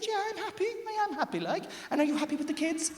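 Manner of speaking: husband's strained falsetto insisting he's happy; squeaky denial. Retold or imitated. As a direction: squeak it out unconvincingly.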